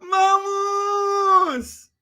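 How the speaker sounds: loud, high cheering shout; big stream cheer; excited shout. Real, not acted. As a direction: hype the donor up at full voice.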